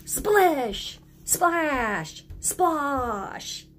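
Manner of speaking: "Splish! Splash! Splosh!" each sung out and falling; playful echo words. Shown as a demonstration. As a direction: lead the kids in splash words. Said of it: "Can you say that with me".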